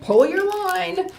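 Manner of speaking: high, slow called order; crying out. Acted. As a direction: draw out the command. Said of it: "he cried".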